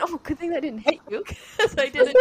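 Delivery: high, loud giggly retelling; laughing. Retold or imitated. Friend retelling hitting Laura, laughing hard. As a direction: laugh through the apology.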